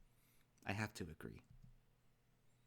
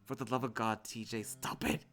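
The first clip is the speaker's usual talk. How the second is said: exasperated protest; 3 semitones higher, 7 dB louder than usual. Acted. Protesting water in face.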